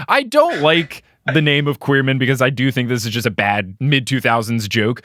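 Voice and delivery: usual speaking voice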